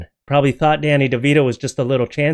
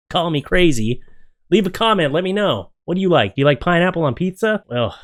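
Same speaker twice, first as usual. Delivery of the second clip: usual speaking voice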